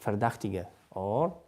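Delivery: usual speaking voice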